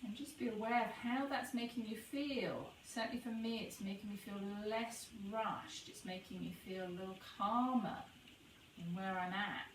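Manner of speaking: soft, unhurried meditation voice with long pauses; guided meditation. Acted. Guide leading listeners to notice the breath pause, soft meditation voice. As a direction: ease them into the breath, gently.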